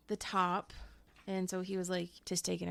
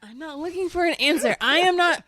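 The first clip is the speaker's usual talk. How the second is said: higher and louder, fed up; exasperated shout; 9 semitones higher, 9 dB louder than usual. Real, not acted. Host snapping at cohost's answer, exasperated shout. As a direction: yell it back at him, exasperated.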